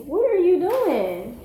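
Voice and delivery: high, loud, drawn-out scolding question. Real, not acted. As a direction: raise your voice and stretch it out, exasperated. Calls out to misbehaving child.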